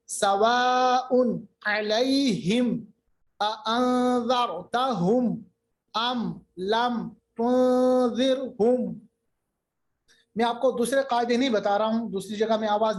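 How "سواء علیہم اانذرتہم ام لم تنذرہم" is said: very slow tajweed recital, piece by piece; clearly sounded mim. Shown as a demonstration. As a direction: stretch سواء long, close علیہم on clear mim. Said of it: "ظاہر کریں گے۔ جیسے". (تجوید سے بہت ٹھہری ہوئی تلاوت)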